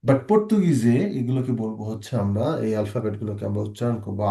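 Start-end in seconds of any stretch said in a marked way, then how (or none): none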